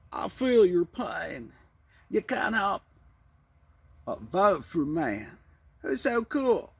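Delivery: slow, high-pitched drawling politician impression; Clinton. Ex-president courting voters, slow mock politician impression. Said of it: "ex-president Clinton".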